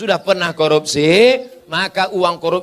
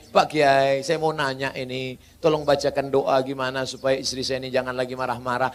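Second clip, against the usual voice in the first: low, much softer pleading husband; meek husband voice; 8 semitones lower, 6 dB quieter than usual. Retold or imitated. Husband timidly asks the kiai for a prayer, meek and much softer.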